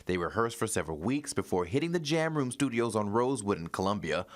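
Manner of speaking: steady, polished newscaster delivery; news read. Acted. Anchor introducing a local musician's story, news read.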